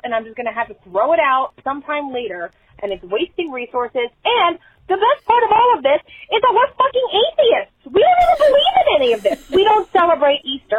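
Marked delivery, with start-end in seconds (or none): 4.2-10.8 high, ranting outburst; exasperated yelling. Real, not acted. Caller ranting to hosts about Easter, exasperated yelling. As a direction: yell it in comic outrage, voice shooting up. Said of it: "I need to stop yelling".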